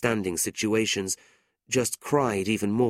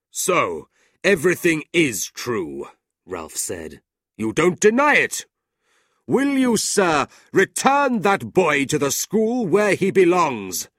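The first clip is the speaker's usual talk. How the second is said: loud, high, slow demand; cold demand; 7 semitones higher, 7 dB louder than usual. A narrator's reading. Ralph coldly demands the boy back. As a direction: corner him.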